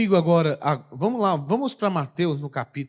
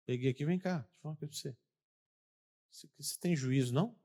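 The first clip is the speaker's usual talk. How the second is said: quiet aside; 10 dB quieter, much faster than usual. Retold or imitated. Taking friend aside privately.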